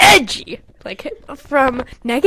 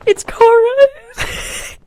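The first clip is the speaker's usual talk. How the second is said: high, drawn-out laughing groan; laughing disbelief; 12 semitones higher, 4 dB louder, much slower than usual. Real, not acted. Reading her childhood story, drawn out laughing. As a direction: stretch it out, cracking up.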